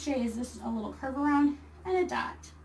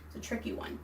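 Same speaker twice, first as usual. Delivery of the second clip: whispered aside; 8 dB quieter, faster than usual. Real, not acted. Aside about silent k.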